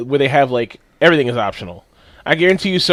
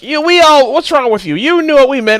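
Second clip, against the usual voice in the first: exasperated friend; 11 semitones higher, 7 dB louder than usual. Retold or imitated. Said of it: "he's like".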